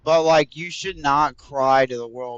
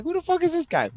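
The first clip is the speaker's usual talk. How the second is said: high, incredulous mocking quote; high-pitched mock sneer; mock disbelief; 14 semitones higher, faster than usual. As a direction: squeak out your old disbelief. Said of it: "I was like".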